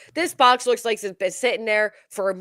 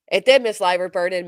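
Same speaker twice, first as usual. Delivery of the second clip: ordinary delivery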